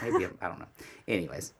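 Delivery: ordinary talk, nothing marked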